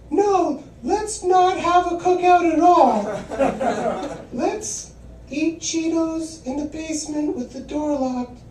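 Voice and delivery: high, slow, sneering mock reply; sarcastic mock retort. Comic retorting to a family suggestion, high laughing sarcasm.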